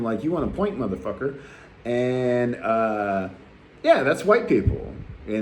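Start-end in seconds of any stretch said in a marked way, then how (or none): none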